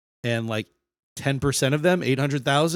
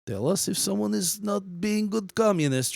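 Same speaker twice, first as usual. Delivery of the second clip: higher-pitched Russian secret police voice; Russian accent; 7 semitones higher than usual. Acted. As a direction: play an informer-hungry Russian officer, Russian accent. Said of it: "secret police that would come around and be like".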